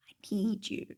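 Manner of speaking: soft unvoiced whisper; hushed pleading whisper; whispered plea. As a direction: breathe it like a late-night plea. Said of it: "he says".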